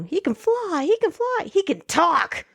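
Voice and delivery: high-pitched, excited mock announcement; amazed cry. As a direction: act out an astonished onlooker, pitch leaping up. Acting out characters' amazed cry.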